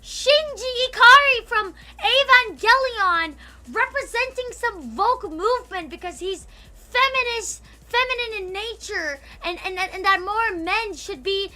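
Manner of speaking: loud high angry ranting; heated rant. Real, not acted. Streamer still fuming about her Shinji take, loud angry rant. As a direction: keep ranting hard.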